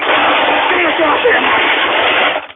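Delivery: strained demand. Acted. Ranting host demanding the mic.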